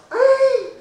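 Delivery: high, loud laughing "Hey"; laughing startled protest; alarmed exclamation. Retold or imitated. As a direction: yelp out a startled objection. Alarmed protest at stealing plums.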